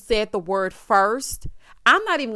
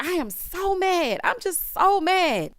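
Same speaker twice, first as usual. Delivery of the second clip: higher, slow mock whine; slow high mock pout; mock whiny wife; 8 semitones higher, slower than usual. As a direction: imitate her pouty mad. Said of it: "And she's like".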